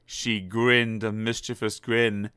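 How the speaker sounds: ordinary delivery, nothing marked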